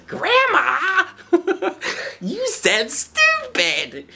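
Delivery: very high squeal of delighted shock; giggling child. Acted. Boy scolds grandma for saying stupid, squealing child's giggle. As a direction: squeal it through giggles like a little kid. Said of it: "And he says".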